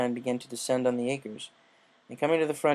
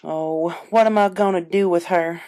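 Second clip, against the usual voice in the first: exasperated; 5 semitones higher, 7 dB louder than usual. Acted.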